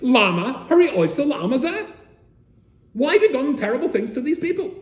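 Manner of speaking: a retold psalmist crying at God; anguished cry. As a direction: cry out to heaven demanding why.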